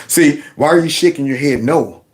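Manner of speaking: usual speaking voice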